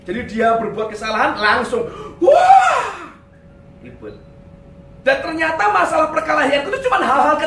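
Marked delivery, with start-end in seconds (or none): 2.2-3.1 loud, very high "waah" outburst; explosive mock yell. Husband reacting instantly to his wife's mistake, explosive yell. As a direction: blow up in one hammed-up shout.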